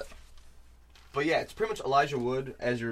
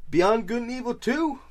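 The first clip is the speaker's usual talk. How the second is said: excited; 12 semitones higher, 9 dB louder than usual. Real, not acted. Hyped naming of awaited game.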